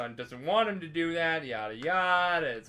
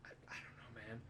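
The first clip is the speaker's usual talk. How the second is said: whispered shrug; 5 semitones lower, 24 dB quieter, faster than usual. Retold or imitated. Geralt's shrugging reply retold.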